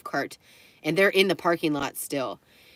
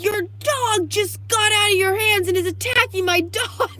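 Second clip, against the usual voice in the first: very high, loud reenacted yelling; screamed retort; 6 dB louder than usual. Bystander yelling back at dog owner, reenacted high screaming. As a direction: yell the accusation back in outrage. Acted shouted reply in dog fight.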